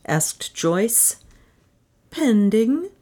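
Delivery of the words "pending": higher, hesitant questioning word; faltering. A narrator's reading. Puzzled secretary hears an inventor's name, faltering. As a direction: falter on it, voice lifting. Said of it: "faltered Miss Thomas".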